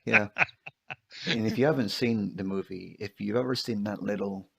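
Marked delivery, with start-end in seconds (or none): 0.1-0.3 agreeing through a real laugh. Real, not acted. Co-host laughs along at the merchant meme joke, laughing. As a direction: yeah yeah, laughing it out.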